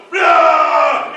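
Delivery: loud, high nonsense yell; hammed-up monster-fight yell; mock roar. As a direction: bellow a garbled battle noise. Acts out spell effect.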